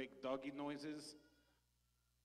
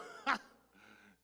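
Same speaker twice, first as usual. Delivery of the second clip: short sheepish laugh; mock embarrassed laugh; 3 semitones lower, 9 dB louder than usual. Laugh after confession.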